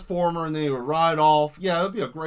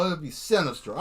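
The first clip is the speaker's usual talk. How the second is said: ordinary talk, nothing marked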